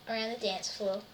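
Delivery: ordinary delivery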